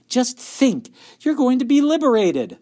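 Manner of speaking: high, loud, gushing exclamation; all enthusiasm. A narrator's reading. Daughter thrilled for her working mother, all enthusiasm. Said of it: "Beezus was all enthusiasm".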